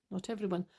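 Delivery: a quiet afterthought; soft repeat. Real, not acted. Softly repeating her own correction to the listeners.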